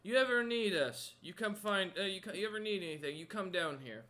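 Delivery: loud giant voice easing off as he goes; booming welcome that settles down; friendly giant. Acted. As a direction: giant offering help to Ned, loud then easing. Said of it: "He's like".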